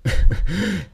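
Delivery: loud, high mocking laugh; villain laugh. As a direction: laugh at the boy's threat. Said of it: "Er lacht".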